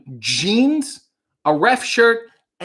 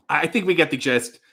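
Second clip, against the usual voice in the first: ordinary talk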